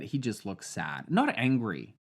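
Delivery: usual speaking voice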